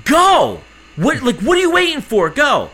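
imitated bark, high and loud; loud, high, exasperated command; impatient agent's yell. Agent barking at waiting passenger.

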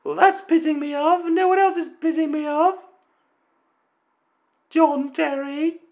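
angry football fan in a high mock female voice; falsetto woman caller. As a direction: squeak it out like a fed-up lady fan.

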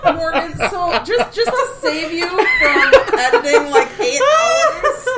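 talking through giggles, pitch climbing; high, helpless laughter breaking up the words; laughing. Real, not acted. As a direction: try to get it out while cracking up. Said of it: "everybody's laughing like little girls".

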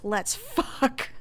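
lightly voiced coked-out late-night visitor; brash come-on. Sean at the door at two in the morning, brash. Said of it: "coked out".

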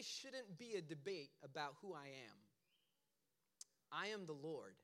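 low, soft divine declaration; God's persona. Acted. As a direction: state it low, as God would speak. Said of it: "he's speaking in this pronunciation, this, this persona of how God would speak".

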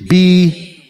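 very slow, deliberate reading; read real slow. Shown as a demonstration. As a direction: stretch out each word. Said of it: "I'm gonna read it real slow".

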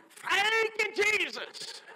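high, surprised-sounding thank you; grouchy man's praise. Retold or imitated. Grouchy officer joining the praise, high. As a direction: let it slip out high. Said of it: "he went".

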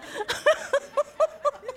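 high squealing laugh; shrieking laugh. Real, not acted. Big laugh.

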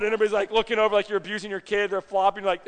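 usual speaking voice

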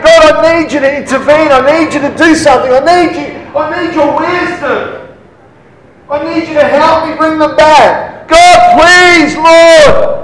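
high, pleading wail to demonstrate crying prayer; loud crying. Shown as a demonstration. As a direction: beg God desperately in a high cry. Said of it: "That's what a loud cry is like".

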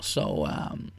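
ordinary talk

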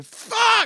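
high-pitched yelped curse; sudden high squawk of pain; retold pain yell. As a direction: cry out as the arm gives way. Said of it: "And I just, like".

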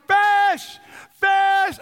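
high shouted call for the crew; excited yell. Retold or imitated. Hooked fisherman yelling for the crew, high shout. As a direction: yell it out to the boat. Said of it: "and I'm like".

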